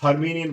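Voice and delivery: हर्मिनियन with the T dropped; silent T. Shown as a demonstration. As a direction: say हर्मिनियन with an N sound, no T. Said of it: "टी silent हो गया".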